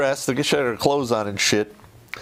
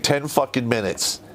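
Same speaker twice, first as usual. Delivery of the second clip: usual speaking voice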